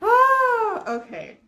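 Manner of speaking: high, loud gasp, then settling; laughing. Real, not acted. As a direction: catch your breath after laughing. Recovering from laughing fit.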